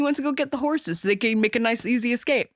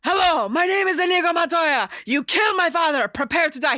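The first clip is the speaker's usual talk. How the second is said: breaking point vow; 7 semitones higher than usual. Retold or imitated. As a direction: hurl the vow at your father's killer. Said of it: "breaking point".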